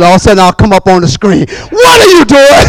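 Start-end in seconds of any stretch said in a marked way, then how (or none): 1.7-2.7 high-pitched pastor's pretend outburst; mock scolding cry. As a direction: burst in and catch them at it, playfully. Acts pastor popping up to scold.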